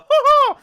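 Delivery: sudden high burst of real laughter; a short high real laugh. Real, not acted. Laughs at own joke.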